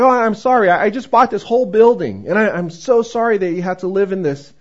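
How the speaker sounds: new owner promising tenant repairs, eager; generous new landlord. Retold or imitated. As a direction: promise everything with open enthusiasm. Kind new owner's offer.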